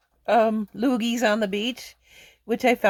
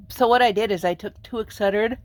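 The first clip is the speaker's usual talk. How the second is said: usual speaking voice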